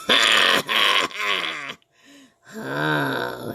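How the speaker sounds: low booming monster laugh; mocking ghoul laugh; deep evil cackle. Rawhead laughs at the boy.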